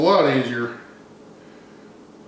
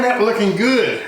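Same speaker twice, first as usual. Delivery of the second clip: normal talking voice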